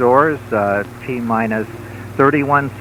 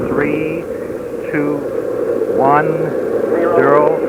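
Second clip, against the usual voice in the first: counting down to go; 4 semitones higher, much slower than usual. Real, not acted. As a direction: build the call up to liftoff. Launch commentator calling liftoff.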